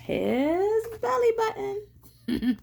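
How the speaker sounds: high, drawn-out, rising surprise; slow, teasing high reveal for children; playful reveal. A narrator's reading. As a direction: stretch the first word high, then land the punchline.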